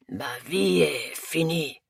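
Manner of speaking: slow, despairing French lament. A narrator's reading. Hugo tells them his life is over, despairing. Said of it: "he repeated, more quietly this time".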